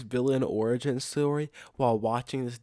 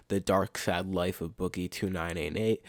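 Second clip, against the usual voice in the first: usual speaking voice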